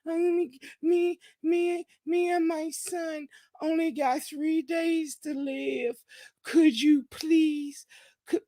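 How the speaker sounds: hammed-up pitiful plea for money; mock begging. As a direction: whine it out like a beggar asking for change. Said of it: "you was over there begging".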